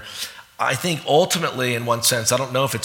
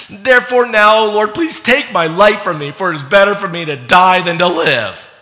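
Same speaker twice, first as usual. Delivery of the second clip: louder, higher, impassioned reading of Jonah's plea; dramatic scripture reading; 6 semitones higher, 6 dB louder than usual. Acted. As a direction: read it as the prophet crying out to God. Reads Jonah's prayer aloud.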